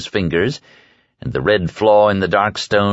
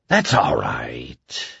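low, slow and soft; calm him like a frightened child; soothing; 7 semitones lower, 4 dB quieter, much slower than usual. A narrator's reading. Flagg soothing the sobbing prisoner, low and slow. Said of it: "Flagg soothed".

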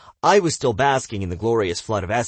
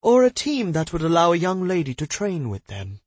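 lady voice; 10 semitones higher, 4 dB louder than usual. Acted. Madame voiced.

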